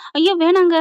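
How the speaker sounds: loud high panicked no; alarmed plea. Retold or imitated. Wife pleads with husband not to, alarmed cry. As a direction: beg him to stop, startled.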